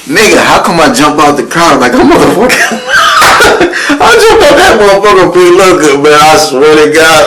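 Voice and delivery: laughing, high and loud; cracking up mid-story. Real, not acted. Laughing retelling.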